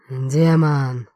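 loud triumphant yell; shout. A narrator's reading. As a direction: roar it to the whole hall. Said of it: "заорал я на весь зал".

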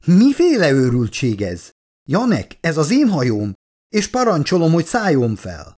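high, outraged commanding voice; cutting in. A narrator's reading. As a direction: interrupt and assert your authority. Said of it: "vágott közbe Meredith Vickers". (felháborodott, parancsoló közbevágás)

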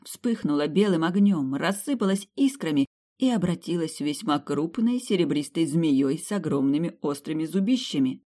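ordinary delivery, nothing marked

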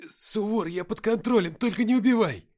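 high, loud desperate begging; frightened plea. A narrator's reading. Nikita begs Suvor not to kill him, terrified plea. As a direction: plead for your life.

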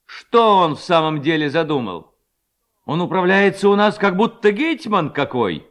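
indignant incitement. A narrator's reading. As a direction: work the lads up against the headman. Levko rouses lads against the headman. (возмущённая речь повышенным тоном)

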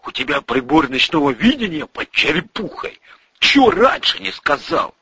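lively acted reproach; gruff comrade challenging. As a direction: put a demanding edge on the question.

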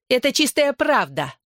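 high, malicious jeer; spiteful, ringing voice. A narrator's reading. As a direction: fling the insult in a ringing voice. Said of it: "злым звонким голосом произнесла Белла". (злым звонким голосом)

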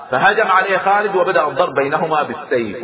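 usual speaking voice

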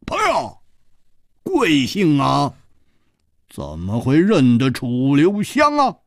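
slow, teasing grin on 楚留香; grinning question. Acted. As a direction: drawl the 楚留香 with a sly grin. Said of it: "笑嘻嘻地说".

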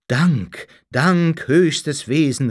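loud, rising exclamation; calling out thanks. A narrator's reading. Dazzled man thanks the supreme being, calling out. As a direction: cry out your gratitude to a god. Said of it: "rief ich".

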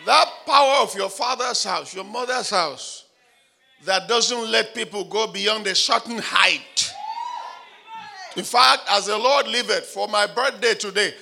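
loud, high declaration over the crowd; fervent shouted decree; full-force preaching. Acted. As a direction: preach it with rising power.

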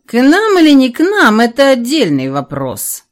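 a hint of a pondering dragon; musing dragon head, lightly voiced; thoughtful dragon. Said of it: "склонилась в задумчивости левая голова".

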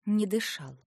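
plain reading voice

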